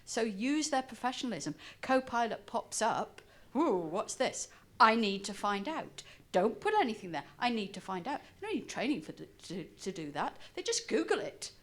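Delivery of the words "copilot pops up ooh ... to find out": ordinary delivery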